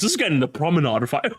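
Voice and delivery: laughing. Real, not acted. Laughing repeat.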